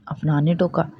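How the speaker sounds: usual speaking voice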